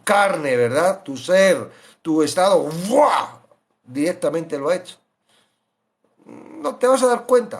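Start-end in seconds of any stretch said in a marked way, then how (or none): none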